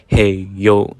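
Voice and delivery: a mild mock yell at the thief; retold yell. Yelling at the thief stealing cans, mildly mock. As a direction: call out with a touch of force. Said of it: "I just yell this".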